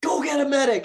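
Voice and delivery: urgent yell for a medic; man down shout. Retold or imitated. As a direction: shout it out, high and loud.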